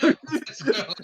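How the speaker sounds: high loud laughing whoop; laughing cheer. Real, not acted. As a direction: cheer through real laughter. Player laughing exuberant cheer.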